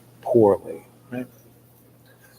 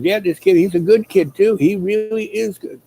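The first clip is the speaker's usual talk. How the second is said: higher, put-on voice for the old man; lightly voiced proud old man; old man's praise; 8 semitones higher than usual. Grandpa's praise quoted in story.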